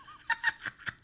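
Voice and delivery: sudden high-pitched giggle; 高い笑い声; squeaky laugh. Real, not acted. Laughing at the model.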